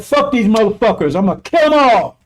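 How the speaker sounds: high, loud furious vow. Acted. Preacher voicing the furious Messiah against devils, furious. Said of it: "He's furious".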